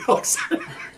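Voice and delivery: high airy laugh. Real, not acted. Laughing at own joke.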